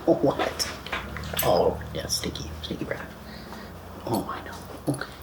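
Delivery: breathy whisper teasing the dog; hushed teasing of a pet; whispered pet talk. Real, not acted. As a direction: murmur fondly to the dog.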